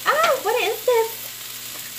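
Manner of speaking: high, drawn-out curious exclamation; slow, high-pitched pretend discovery; curious reaction. Cook mimics viewer finding spices in the rice, curious and high-pitched. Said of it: "be like".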